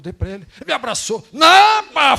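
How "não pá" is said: sudden high shout, much louder; shouted exclamation. Retold or imitated. Preacher retelling the tall man's hug, shouting. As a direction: burst out with a loud, high cry.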